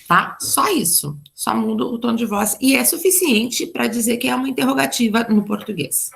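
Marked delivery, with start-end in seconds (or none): none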